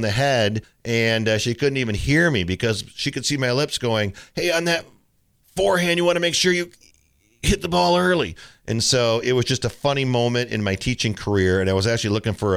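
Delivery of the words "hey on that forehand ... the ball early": higher mock coaching voice; lightly put-on mumbling coach; mumbled coaching. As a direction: imitate yourself mumbling tips on court, pitched up. Mimicking his own mumbled coaching.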